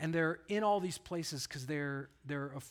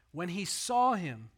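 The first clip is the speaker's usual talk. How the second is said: "saw" punched louder, phrase slowed; slow down and land hard on "saw"; stress on saw; 6 dB louder, slower than usual. Shown as a demonstration. Preacher insisting the seeing matters, "saw" stressed slowly. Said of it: "that's not an accident".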